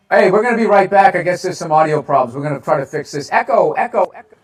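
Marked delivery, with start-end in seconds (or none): 3.3-4.4 mock echo fading away; canyon echo. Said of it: "there's an echo".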